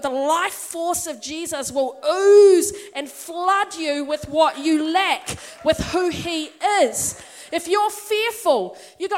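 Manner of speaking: preacher flooding the church with assurance; fervent preaching. Acted. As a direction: pour it out over the congregation. Preaching builds to full force.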